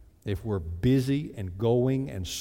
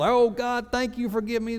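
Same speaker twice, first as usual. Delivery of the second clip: mock pious gush, pitched much higher; fake spiritual; 10 semitones higher than usual. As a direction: put on fake holiness. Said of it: "We try to put on this big spiritual".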